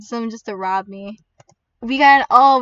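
ordinary talk

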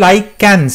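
"লাইকেন্স", licence misread with a k sound; misspelling read aloud. Shown as a demonstration. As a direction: say "লাইকেন্স" with a hard k for the c. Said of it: "option B তো হচ্ছে".

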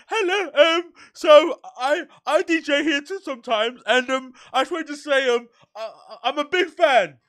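high, breathless fanboy squeak; nervous starstruck fan. Retold or imitated. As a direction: gush at him like a starstruck kid. Nervously introducing himself to Harry.

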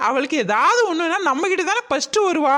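ordinary talk, nothing marked

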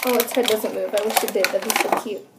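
usual speaking voice

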